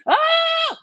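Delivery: high, loud mock shriek; wordless yelp, imitating someone's screaming; imitated yelling. Retelling the girl yelling over Tory's calm words, imitated yelling. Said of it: "she's yelling".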